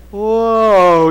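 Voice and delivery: long drawn-out mock amazement; awed whoa. Imitating little boy seeing huge cone, awed. Acting out boy's awe at cone.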